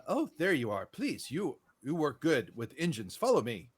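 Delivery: put-on gently French-accented officer; light French accent. Officer summoning Lula to the engineering tents, light French accent. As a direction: give it a light French lilt. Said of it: "in a light French accent".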